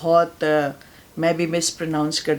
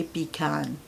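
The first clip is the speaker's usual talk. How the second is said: American "पीकान", "pi-KAHN" with a long open second vowel; "पीकान" said the American way, stress on "कान"; American English "पीकान"; American pronunciation; 6 dB quieter, much slower than usual. Shown as a demonstration. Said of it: "American बोलेंगे".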